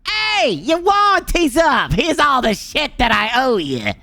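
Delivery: high, slow mocking voice of his aging body; the body talking. Aging body tells him the warranty's up, high and slow. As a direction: play your body as a smug creditor, high and drawn out. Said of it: "your body's like".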